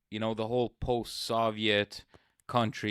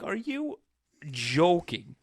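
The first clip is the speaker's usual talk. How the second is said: retold disbelief, pitched up on "Are you"; incredulous; 6 semitones higher, slower than usual. Victim to police who came on a bicycle, incredulous. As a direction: throw it out in high disbelief.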